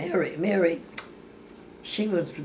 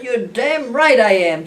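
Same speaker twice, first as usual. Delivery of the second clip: loud, higher, slowed reply; retold angry retort; 7 semitones higher, 10 dB louder, slower than usual. Answering her sister, a loud mock angry retort. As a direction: bark it back hammed-up. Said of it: "I said".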